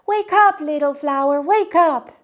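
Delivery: high, loud eager call; bright child's wake-up cry. Acted. Miyuki calling to wake her flower, high and loud.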